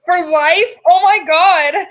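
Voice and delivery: ordinary talk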